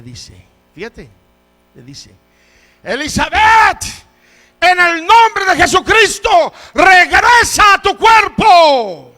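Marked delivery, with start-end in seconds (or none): 2.8-9.1 very high, slow, full-force command; thunderous drawn-out prayer of authority; commanding shout. Retold or imitated. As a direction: call her back at the top of your voice. Acts brother commanding dead girl to return.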